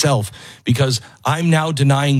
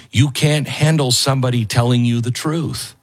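normal talking voice

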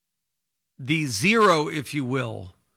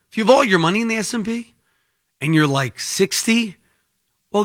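ordinary talk

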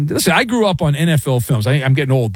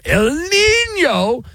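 drawn-out high call; 13 semitones higher, much slower than usual. Pretend. Host joking about hot weather, calls El Nino high and slow.

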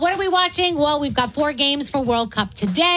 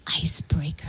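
breathy whisper, no voice; hushed, unvoiced title read; 7 semitones lower, 6 dB quieter than usual. Shown as a demonstration. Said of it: "You have to say it".

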